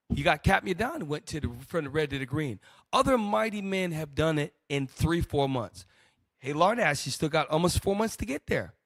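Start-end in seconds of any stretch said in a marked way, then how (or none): none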